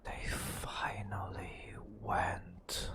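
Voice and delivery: ordinary talk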